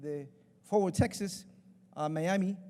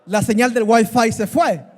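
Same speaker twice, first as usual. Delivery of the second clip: hammed-up loud slow sob over lost Wi-Fi; mock hysterical wail; 4 semitones higher, 16 dB louder, slower than usual. Preacher mocking how young people cry, loud hysterical wail. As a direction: imitate a young man bawling, loud and slow. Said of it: "Es así como lloran ellos, se ponen histéricos".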